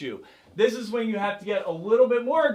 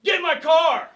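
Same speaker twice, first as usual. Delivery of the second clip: high, louder predator shout; 9 semitones higher, 7 dB louder than usual. Acted. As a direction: bark it at the class. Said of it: "I'm gonna get louder".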